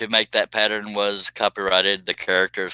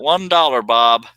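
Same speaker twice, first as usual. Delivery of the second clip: auctioneer call; 4 semitones higher, 5 dB louder, slower than usual. Acted. Mock auction bid call.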